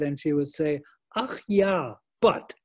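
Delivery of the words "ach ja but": mother's higher-pitched German "Ach ja"; German accent. Retold or imitated. Son quoting his mother's reply, German accent. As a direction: imitate a German-accented mother conceding, then objecting. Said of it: "she had, had this great German accent".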